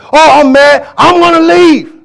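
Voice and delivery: high, loud mock huffy quitter; angry churchgoer. Preacher mocking a churchgoer who quits in a huff, high and loud. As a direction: play the sulking member storming out. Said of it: "They get".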